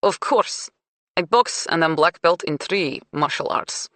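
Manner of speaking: put-on lightly accented proud woman; cool, blunt foreign woman; Russian-accented woman. Svetlana voiced with accent.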